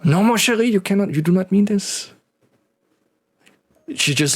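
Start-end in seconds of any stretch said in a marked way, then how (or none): 0.0-2.1 put-on high, imploring French-accented voice; pleading French-accented husband. Husband protesting to his wife, pleading French accent.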